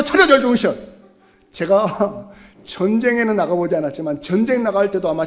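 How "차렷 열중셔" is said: loud barked marching commands; drill sergeant. Retold or imitated. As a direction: shout it on the march. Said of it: "행군할 때도". (행군 구령 외침)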